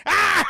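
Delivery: high shouted laughter; loud cackling laugh. Real, not acted. Streamer laughing hard at the shooting. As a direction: burst out laughing.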